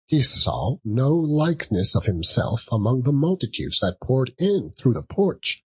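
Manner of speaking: usual speaking voice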